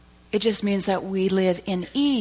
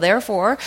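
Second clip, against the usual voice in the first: therefore lifted into a question; 3 semitones higher, 7 dB louder than usual. Shown as a demonstration. Preacher quizzing the church on therefore. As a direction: pose therefore like a riddle to the congregation. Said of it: "what's that therefore".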